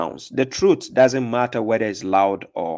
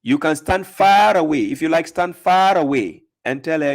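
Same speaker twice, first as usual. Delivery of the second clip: ordinary talk, nothing marked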